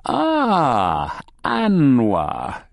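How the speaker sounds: long, high, falling drawl; calling gnome. A narrator's reading. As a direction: draw out the greeting as the gnome. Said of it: "calls the gnome".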